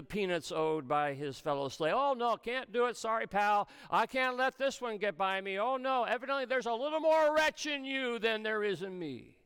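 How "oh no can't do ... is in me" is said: high-pitched, self-righteous acted refusal; dismissive refusal. Slave refusing to forgive his fellow slave, dismissive. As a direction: turn him down, voice pitched up.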